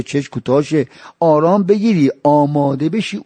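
usual speaking voice

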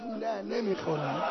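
very soft, falling murmur; hushed refusal. Acted. Thirsty horse refusing water before its master, hushed refusal. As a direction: refuse almost under the breath.

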